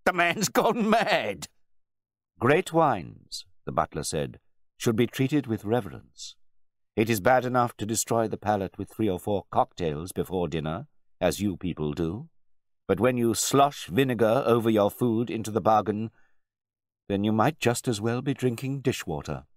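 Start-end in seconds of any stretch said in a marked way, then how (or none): 0.1-1.5 slow, loud, high-pitched exclamation; drawn-out indignant outburst; outraged cry. A narrator's reading. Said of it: "cried out to his guests". 2.4-3.4 raised, emphatic pronouncement; dignified butler. A narrator's reading. Butler lectures the table. 4.8-19.4 firm, higher-pitched rebuke; dignified butler. A narrator's reading. Butler lectures the table.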